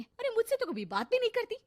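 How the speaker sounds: high, loud grumbling; put-out wife protesting to her husband; indignant complaint. Acted.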